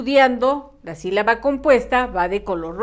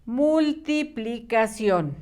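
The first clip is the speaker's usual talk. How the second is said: Multiplicación slow and softer, syllable by syllable; unhurried Multiplicación with every syllable separate; careful slow dictation; 5 dB quieter, much slower than usual. Shown as a demonstration. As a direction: sound out Multiplicación evenly and quietly. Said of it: "Aquí sí ten mucho cuidado".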